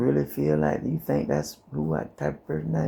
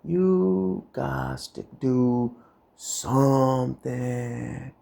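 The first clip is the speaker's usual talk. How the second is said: very slow, every word stretched; drawn-out urging; much slower than usual. Acted. Urging the viewer to act, slowly stretched out. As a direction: drag each word out to make the point land.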